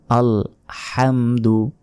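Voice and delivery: very slow "আল হামদু", হা from the throat; correct pronunciation. Shown as a demonstration. Teacher drilling viewers, slow throaty "আল হামদু". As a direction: drag out "আল হামদু" with a guttural হা. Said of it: "সঠিক উচ্চারণ করবেন".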